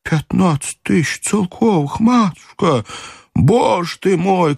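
high, slow wailing servant; lamenting old servant. A narrator's reading. Old servant lamenting to his mistress, high and slow. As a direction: wring your hands over the loss. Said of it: "умилительно всплеснув руками".

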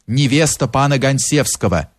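ordinary delivery, nothing marked

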